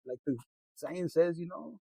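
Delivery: ordinary delivery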